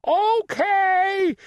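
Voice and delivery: high, stretched shout; shouted reply. A narrator's reading. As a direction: shout back high, drawing it out. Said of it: "shouted Froggy".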